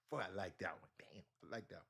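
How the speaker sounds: unvoiced private whisper of approval; host enjoying a guest's comment, whispered; whispered aside. Real, not acted. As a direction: breathe it to yourself, impressed.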